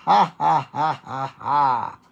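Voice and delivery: loud, defiant string of ha's; laughing at sadness. Shown as a demonstration. Preacher laughing at whatever causes sadness, loud deliberate laugh. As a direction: laugh forcefully at the trouble. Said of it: "We laugh at".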